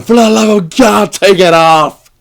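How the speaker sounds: loud, high-pitched frantic yell; desperate shouting. Acted. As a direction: bellow it down the phone in a panic. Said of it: "I would be there going".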